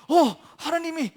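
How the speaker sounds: loud, high alarmed commands; rally the people as a panicked king; urgent king. Acted. King rallies city in emergency.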